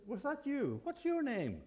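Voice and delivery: a jumpy burglar calling into the dark; startled burglar. Acted.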